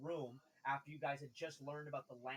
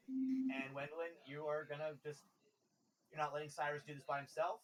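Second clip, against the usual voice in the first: ordinary delivery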